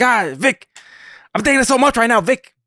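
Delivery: high squawky Penguin voice; Penguin impression. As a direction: squeak it out as the Penguin. Mock Oz frustrated rant.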